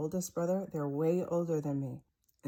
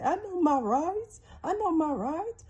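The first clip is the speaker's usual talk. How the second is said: much higher, louder, panicked I know my rights; voice up an octave; 13 semitones higher, 8 dB louder than usual. Retold or imitated. Dad panicking at the door, pitched way up and loud. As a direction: squeak it out high in fear. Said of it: "put fear inside of my dad's voice".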